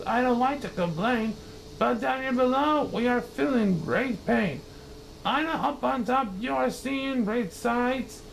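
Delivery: high, pained pleading; groaning plea. Acted. Mac crushed at the bottom, high pained plea. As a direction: beg through the pain. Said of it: "groaned Mac".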